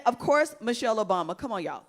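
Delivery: ordinary talk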